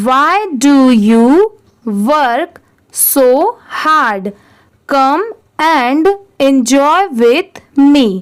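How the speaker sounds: very slow, word by word read-aloud with long pauses; slow story reading. Acted. Teacher reading the story to students, slow dictation. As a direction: read each phrase slowly for the class to repeat.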